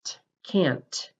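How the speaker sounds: "T, can't" with a crisp voiceless t cutting the word off; voiceless t demo. Shown as a demonstration. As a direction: say "can't" ending on a sharp stop. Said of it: "Now try saying the T sound".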